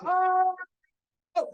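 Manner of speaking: high, stunned little oh; starstruck gasp. Retold or imitated. Founder realizing he is on a call with [name], a high, stunned oh. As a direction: let it slip out, amazed. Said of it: "I'm like".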